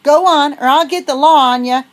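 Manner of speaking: high, very loud bellow. Acted. Old farmer bellowing after the fleeing girls. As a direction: roar the threat after them. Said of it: "he bellowed".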